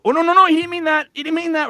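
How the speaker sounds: high, loud, rapid-fire protest; frantic damage control, acted out; panicked denial. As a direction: blurt it out in a squeaky panic. Retold panic at friend's dark joke.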